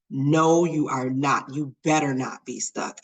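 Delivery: usual speaking voice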